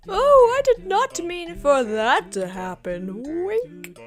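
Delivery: drawn-out, high mock-innocent voice; Ganthet, feigning innocence. Guardian pretending he never meant it to happen, coy. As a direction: overdo the innocence, stretching each phrase slowly.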